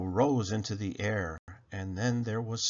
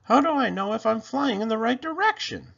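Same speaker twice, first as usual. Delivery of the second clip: panicked elf; 12 semitones higher, 5 dB louder, faster than usual. A narrator's reading.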